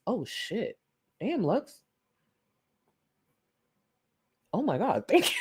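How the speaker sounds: hushed, stunned gasp of thanks, pitch jumping up; real surprise. Real, not acted. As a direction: caught off guard by a gift, soft and high. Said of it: "Threw me off".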